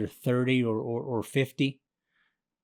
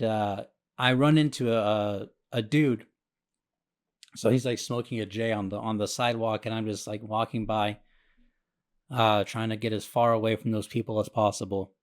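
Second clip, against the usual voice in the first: ordinary delivery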